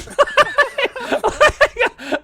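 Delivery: high loud burst of laughter; can't hold back the laugh. Real, not acted. Retelling the Snaps story, loud laughter.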